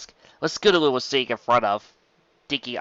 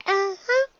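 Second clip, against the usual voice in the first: proud Dinky. Acted. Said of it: "Dinky confirmed".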